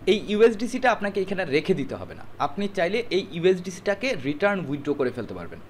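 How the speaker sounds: ordinary delivery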